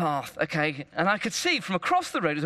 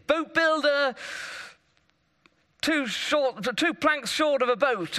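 heckling comics; 7 semitones higher, slower than usual. Acted. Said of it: "the comics of the day".

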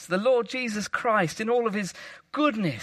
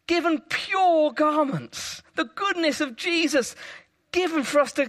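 preaching fervour; 7 semitones higher than usual. Real, not acted. Preacher to congregation, joyful full-force preaching. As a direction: lift the congregation with joy.

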